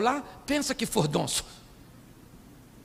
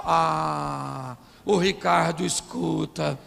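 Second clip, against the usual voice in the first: mock swooning, lower drawn-out words; dreamy gushing mimicry; 5 semitones lower, much slower than usual. Preacher imitates a wife praising another man, dreamy mock gushing. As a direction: gush slowly in a sing-song put-on voice.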